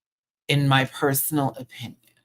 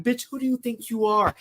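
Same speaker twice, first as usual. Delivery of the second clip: mock Versex; 8 semitones higher than usual. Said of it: "Versex going".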